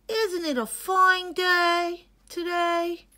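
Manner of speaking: put-on high, slow, childlike hippo voice; a gentle young hippo calling his friend; Hilmi the hippo. Hilmi greets the dragonfly.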